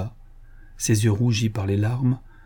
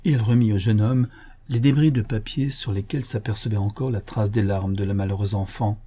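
usual speaking voice

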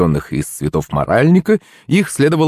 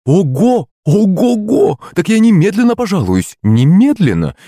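high-pitched scandalized outburst; indignant mother at the top of her register; shocked outrage; 10 semitones higher, 3 dB louder than usual. A narrator's reading. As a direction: exclaim in horror, threatening to complain. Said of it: "Юлина мама была просто в шоке".

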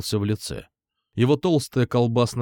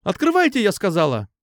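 insistent demand; 8 semitones higher than usual. A narrator's reading. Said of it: "не сдавалась девочка".

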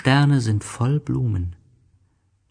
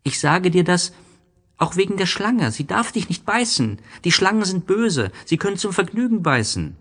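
put-on anxious, higher child's voice; worried little prince; 10 semitones higher than usual. A narrator's reading. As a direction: fret over the snake. Aber er war voll Sorge. (kleiner Prinz, besorgt und ängstlich, höher)

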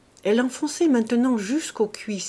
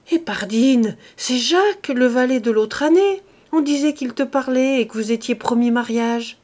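loud, higher burst of sudden certainty; exclaiming; 3 semitones higher, 7 dB louder than usual. A narrator's reading. Farmer suddenly naming Jacques as the lover, exclaiming. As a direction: pounce on the answer, loudly. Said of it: "l'homme s'écria".

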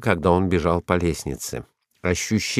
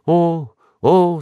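high moan of terror; 9 semitones higher, 4 dB louder than usual. A narrator's reading. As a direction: moan "oh" high, half-fainting with fear. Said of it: "стонала Рита". (испуганный стон)

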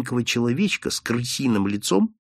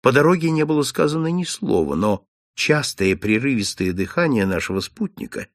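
ordinary delivery

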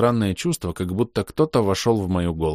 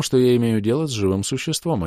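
plain reading voice

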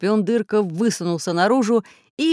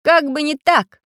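loud, high, confident retort; cheerful; 8 semitones higher, 6 dB louder than usual. A narrator's reading. Inventor surfacing from the water, cheerfully defiant. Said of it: "весело сказал Самоделкин".

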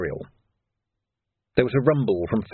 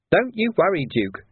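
higher, sneering villain voice; sly thug Carcer; 8 semitones higher than usual. A narrator's reading. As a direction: voice Carcer as a grinning menace.

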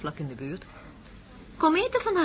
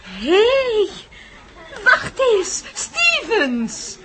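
much higher and louder, delighted recognition; surprised call-out; 12 semitones higher, 7 dB louder, much slower than usual. Acted. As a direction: call out across the café in glad surprise. Spots old acquaintance in café.

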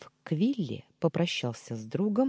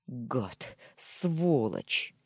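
growled curse; much slower than usual. A narrator's reading. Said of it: "прорычал я".

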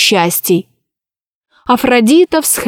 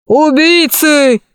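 slow, strained alarmed shout; 4 semitones higher, slower than usual. A narrator's reading. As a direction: bellow it like a panicked guard. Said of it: "закричал он".

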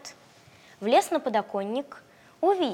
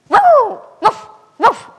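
very high, loud barking; puppy bark; 12 semitones higher, 15 dB louder, much slower than usual. Acted. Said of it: "залаял щенок".

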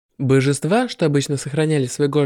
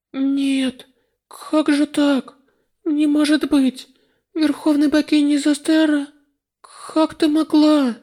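distraught; 10 semitones higher, slower than usual. Acted. Heroine sees goddess killed.

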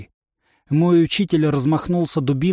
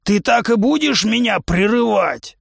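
exasperated trainer; 7 semitones higher than usual. Acted.

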